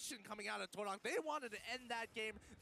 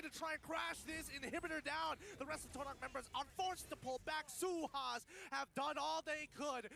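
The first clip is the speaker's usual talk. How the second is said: esports caster; 8 semitones higher, 5 dB louder than usual. Real, not acted. Hyped play-by-play of a fight.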